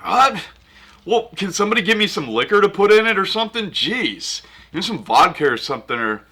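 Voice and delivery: ordinary talk, nothing marked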